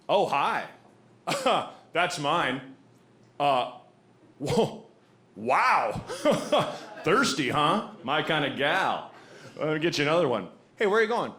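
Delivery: lightly voiced sleazy flirt; a sleazy guy chatting up the woman, lightly voiced; audiobook version of the bar guy. Sleazy bar guy hitting on her, lightly voiced. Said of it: "So I'll just do the audiobook version".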